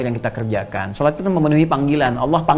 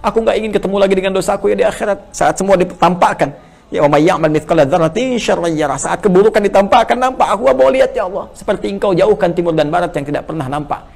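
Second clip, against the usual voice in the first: louder, higher, urgent pleading to God; fervent prayer; 5 semitones higher, 4 dB louder than usual. Acted. Preacher pleading loudly not to see his sins on judgment day. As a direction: cry out the plea with rising urgency.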